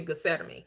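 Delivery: "Gethsemane" said geh-SET-uh-mee; church pronunciation. Shown as a demonstration. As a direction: end "Gethsemane" on a short "mee", with T for TH. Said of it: "they always pronounced it".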